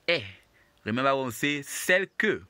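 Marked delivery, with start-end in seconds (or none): 0.1-0.2 E voiced as the "air" vowel; the "air" sound. Shown as a demonstration. Teacher showing what que does not have, E as "air" sound. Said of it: "it's not pronounced". 1.6-2.4 "celle que" with the "air" vowel only on celle; que without "air" sound. Shown as a demonstration. Teacher reminding learners how celle que goes, "que" without the "air" sound. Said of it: "the que here doesn't have the air sound".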